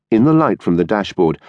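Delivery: plain reading voice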